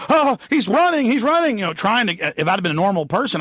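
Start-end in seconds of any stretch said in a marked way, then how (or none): 0.1-1.6 high, loud imitated alarm shout; mock guard shout. Guard shouts that the calm traveller is running: mock alarmed shout. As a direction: cry out like a jumpy guard.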